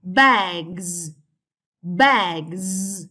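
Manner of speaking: bags with a long buzzing z at the end; bags, said slowly as bagzzz; drawn-out final Z. Shown as a demonstration. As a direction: stretch the last sound of bags into zzz. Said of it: "we say".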